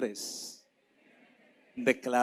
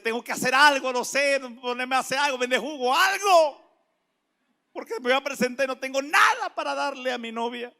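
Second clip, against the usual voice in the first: raised, urgent retold worry; desesperación actuada; 9 semitones higher, 6 dB louder than usual. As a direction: fret aloud, jumping up on the key word. Acts out his desperate worry. (angustia fingida, con la voz subida)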